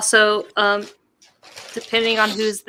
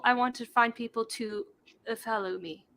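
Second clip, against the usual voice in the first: ordinary talk, nothing marked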